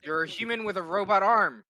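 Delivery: loud, high-pitched insistence. Acted. Bickering over being a cyborg.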